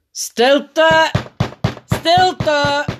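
high, loud, drawn-out call; orde roepen. A narrator's reading. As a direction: call the hall to silence. Opperpiet calls the meeting to order. (luid om stilte roepend)

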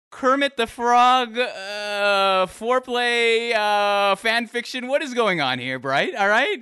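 loud, high, laughing disbelief; mock outrage through laughter. Real, not acted.

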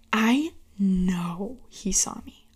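hushed, slow whisper. Retold or imitated. Telling viewers he spotted her, whispered. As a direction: lean in and whisper the secret. Whispered aside about being seen.

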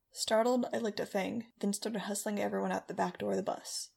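plain reading voice

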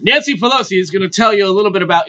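mock event host. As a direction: introduce the next speaker to a crowd. Plays host of party launch.

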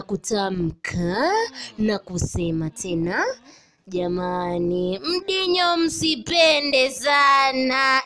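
slow, solemn elder's saying; slow mock solemn proverb; proverb recitation. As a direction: recite it like ancestral wisdom.

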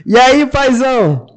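high, loud, drawn-out phone greeting; saudação efusiva. Real, not acted. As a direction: call out to a buddy on the line. Cumprimenta amigo ao telefone. (cumprimento animado, bem alto)

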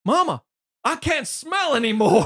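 high, loud, drawn-out wail; mock anguished cry. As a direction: call out to mama in hammed-up distress. Acts kid crying out to mama.

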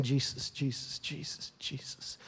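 breathless whispered plea, fading to nothing; hushed desperate whispering; whispered prayer. Retold or imitated. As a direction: whisper the name as a prayer you can't finish. Desperate prayer while pacing, unable to pray.

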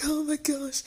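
high, softish mock-tearful voice; high little tearful wail, acted; retold sobbing. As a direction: imitate being overcome with tears. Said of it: "I'm sobbing".

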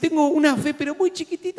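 high small voice for a little faith; tiny voice. Retold or imitated. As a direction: squeak it out like it's tiny. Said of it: "si vos decís". (vocecita aguda y chiquita)

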